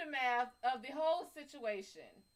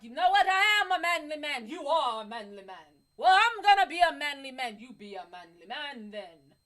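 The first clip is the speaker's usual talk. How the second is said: loud, hammed-up boastful voice; mock proud guy; 9 dB louder than usual. The guy now believing he's manly, hammed-up and loud. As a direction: puff up and boast. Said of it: "it's like".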